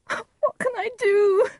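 high, slow tearful wail; very high despairing sob; crying. Acted. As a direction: wail helplessly through tears. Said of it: "Juliet cried".

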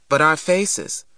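ordinary delivery, nothing marked

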